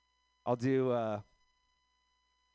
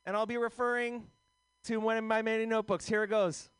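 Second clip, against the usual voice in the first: higher, earnest comic opening his set; Pancake impression; 9 semitones higher than usual. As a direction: play a nervous comic starting with a ritual, pitched up. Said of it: "Here's my impression of Pancake".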